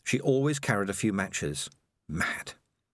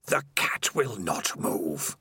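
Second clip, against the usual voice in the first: slow, ghostly whisper from the dark; a creeping, hissing whisper of a lurking monster; eerie spider voice; slower than usual. Acted. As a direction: breathe it like a spider in his head. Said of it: "said the voice of Spider".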